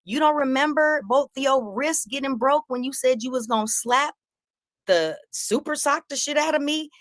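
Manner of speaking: high, mocking taunt. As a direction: tease him from way up high. Taunting Corey in the street.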